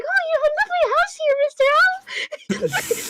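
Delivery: high hammed-up mother voice; mom voice. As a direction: pitch way up like a visiting mom. Mom visiting owl's house.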